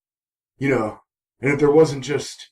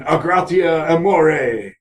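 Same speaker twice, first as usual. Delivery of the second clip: mock incantation; 3 semitones higher, slower than usual. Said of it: "they whisper an incantation".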